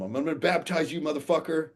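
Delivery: loud, high-pitched declaration; retold shout. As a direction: call it out boldly, re-enacting the moment. Retells shouting at client in water.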